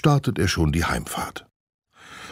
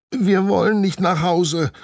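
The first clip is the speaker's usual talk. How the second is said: put-on higher child's whine, mild; whining; 14 semitones higher, 3 dB louder than usual. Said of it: "jammert Anne".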